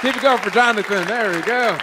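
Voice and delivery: loud crowd-rallying call; announcer hype. Real, not acted. Host to the crowd after the set, loud announcer hype. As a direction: pump up the audience.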